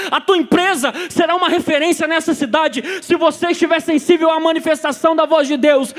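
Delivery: loud, fervent preaching climax; preaching at full force. Acted. Preacher rousing the church to hear God, full-force preaching. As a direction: build the sermon to a roaring peak.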